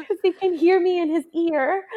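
laughing. Real, not acted. Laughing about planner husband.

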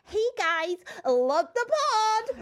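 high, put-on gushing listener voice; mock fan voice. Mocking listeners who message the podcast, high gushing voice. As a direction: gush like an over-sweet fan before the catch. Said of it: "We keep getting messages like".

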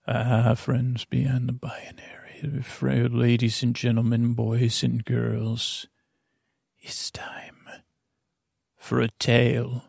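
hushed, slow whispered show intro; whispered kids show opener. Acted. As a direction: breathe the welcome like a secret. Podcast opener addressing audience.

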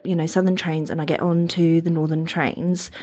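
normal talking voice

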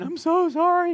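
mock weeping plea; imitating crying. Girl at campfire crying through her testimony, imitated sob.

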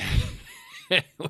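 sudden high squeal of laughter; laughing. Real, not acted. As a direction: burst out laughing mid-story. Breaks up before the punchline.

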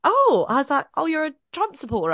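high, sarcastic "oh"; mock surprise. Reacting to his Trump hat, in high sarcastic surprise. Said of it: "And I'm like".

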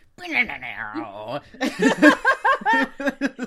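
big helpless laugh, loud; cracking up loudly at the joke; loud burst of laughter. Real, not acted. A podcast host cracks up at her co-host's joke, laughing loud.

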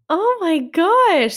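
delighted surprise, drawn out; high, slow surprised exclamation. Real, not acted. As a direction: stretch it with amazement. Baby size news.

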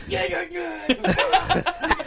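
high, goofy babbling squeal; mock the zoned-in friend cooing in a silly high voice; Rob's stupid voice. Friend too absorbed by the snake to answer, stupid high voice. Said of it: "he's just talking to the snake".

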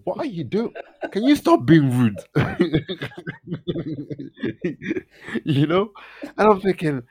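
high, loud, laughing telling-off; indignant reprimand breaking into giggles; mock scolding through laughter. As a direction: act outraged while cracking up.

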